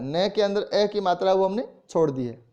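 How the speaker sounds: ordinary talk, nothing marked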